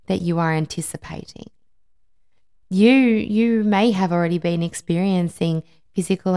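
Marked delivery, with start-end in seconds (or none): none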